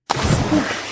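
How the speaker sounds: loud breathy splash noise. Acted. Mister Bump falling into the fountain, loud splash. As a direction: burst out a big splash.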